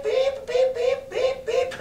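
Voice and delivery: high sharp beeping; censor beeps. Shown as a demonstration. Teacher bleeping the parrot's swearing, high beeps. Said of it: "dropping bombs and beep".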